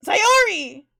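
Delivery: loud, startled call of a name; acted startled cry; startled exclamation. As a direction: blurt the name out in surprise. Character startled by Sayori's arrival.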